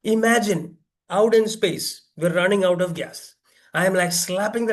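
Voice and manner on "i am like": normal talking voice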